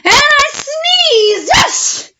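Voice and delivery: wolf's enormous sneeze shouted out; huge sneeze. Acted. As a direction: blast the sneeze out big. Big sneeze climax in story.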